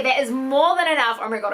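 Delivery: ordinary talk, nothing marked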